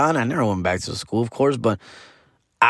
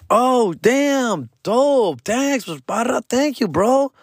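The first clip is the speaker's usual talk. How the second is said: impressed thanks; 13 semitones higher, much slower than usual. Retold or imitated. Acted grateful student receiving beer.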